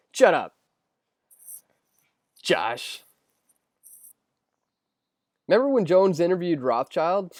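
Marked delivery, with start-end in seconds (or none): none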